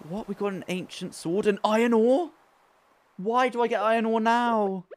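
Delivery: loud high incredulous cry; surprised outburst. Real, not acted. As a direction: exclaim in amazed disbelief.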